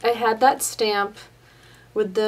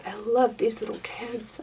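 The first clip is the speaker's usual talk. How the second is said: whisper; 3 dB quieter than usual. Real, not acted. Crafter admiring the kids stamp.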